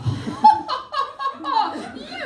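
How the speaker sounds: high, loud mock-evil cackle; villainous laugh. Dying partner revealing secrets, hammed-up high cackle. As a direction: cackle gleefully, long and high.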